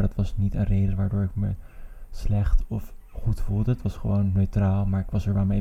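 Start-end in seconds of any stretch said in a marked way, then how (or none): 2.1-3.7 "slecht of goed voelde" spelled out slowly, word by word; slow and deliberate. Real, not acted. Repeats the same words slowly.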